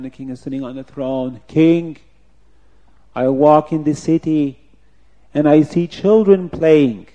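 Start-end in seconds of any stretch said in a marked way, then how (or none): 1.5-7.1 loud, measured put-on officer; officer's formal report. Officer bringing his tale to the king, loud formal voice. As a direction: proclaim it slowly before the throne.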